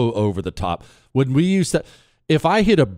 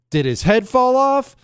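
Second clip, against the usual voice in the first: higher, louder joking quote; cheeky retort; 12 semitones higher, 4 dB louder than usual. Retold or imitated. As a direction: tease the upset wife with the movie line. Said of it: "to which I said".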